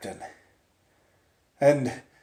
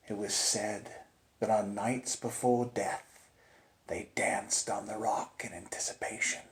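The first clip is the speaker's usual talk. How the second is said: hushed whisper; 4 semitones lower, 13 dB quieter than usual. A narrator's reading. Ghost story, hushed telling.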